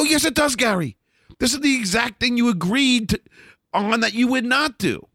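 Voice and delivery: high-pitched indignant retort; heated reenacted confrontation; retold angry outburst. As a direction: relive the fury at being thrown under the bus. Confronting Gary after betrayal.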